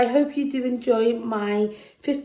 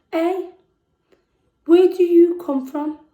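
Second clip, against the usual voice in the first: higher, louder call from across the street; boy shouting; 6 semitones higher, 7 dB louder than usual. Acted.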